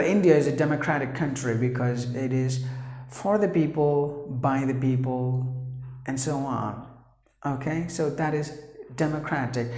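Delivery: normal talking voice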